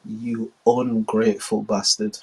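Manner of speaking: cold menace, quietly; quiet, slow contempt; cold China. Acted. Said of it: "China, like".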